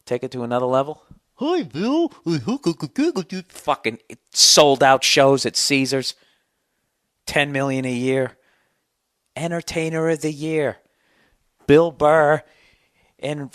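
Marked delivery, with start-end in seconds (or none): 1.4-2.1 very high, silly puppet squeak; squeaky dummy says hi; stupid voice. Pretend. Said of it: "I gotta have a stupid voice". 3.5-10.8 mock announcement. Mocking puppet act success.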